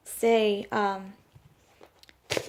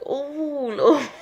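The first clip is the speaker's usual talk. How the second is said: a sheepish laugh breaking through; 5 semitones higher, 4 dB louder than usual. Real, not acted. Laughing at herself for misreading what the Lord meant. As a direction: laugh at yourself for getting it wrong.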